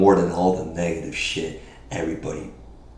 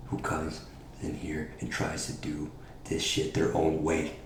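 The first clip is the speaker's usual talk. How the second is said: quiet menacing taunt; 5 semitones lower, 15 dB quieter than usual. Acted. Hushed taunt in promo.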